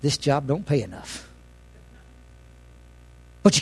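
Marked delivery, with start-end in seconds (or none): 0.0-1.3 muttered inner thought. Retold or imitated. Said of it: "thinking in my mind".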